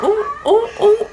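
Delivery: high teasing crowd ooh with a laugh. Retold or imitated. Cafeteria crowd hooting at the fight, high and laughing. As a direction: hoot like the cafeteria watching a fight. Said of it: "everybody was like".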